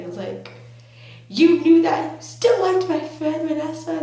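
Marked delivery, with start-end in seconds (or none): 1.3-4.0 loud, high-pitched indignation; acted outraged accusation. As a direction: confront a crush in loud mock outrage. Retold confrontation with crush.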